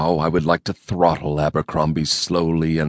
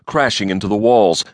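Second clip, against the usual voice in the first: ordinary delivery, nothing marked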